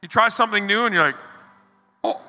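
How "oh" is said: talking through a bite; mouth full. Pretend. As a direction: say it with food in mouth. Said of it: "Talking through the bite".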